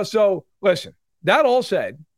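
normal talking voice

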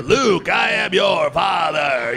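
slow, booming famous film line; hammed-up, drawn-out movie villain; mock trailer announcer. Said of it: "how ridiculous it sounds".

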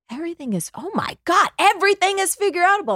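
high, loud, quick eureka burst; ecstatic realization. Retold or imitated. Daughter grasping mom's motto, bursting out high and thrilled. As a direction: explode with sudden discovery.

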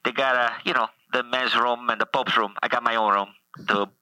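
put-on Italian accent as the Pope; thick Italian-English accent, playing the Pope; comic Italian accent as the Pope.

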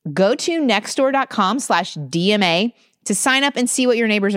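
polished, measured podcast ad delivery; host-read promo with a lilting pitch; sponsor ad read. Real, not acted. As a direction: sell it warmly to listeners, clearly enunciated.

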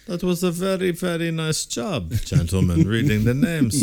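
comic Borat-style praise; mock Borat accent. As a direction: ham up a Borat impression. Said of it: "Borat voice".

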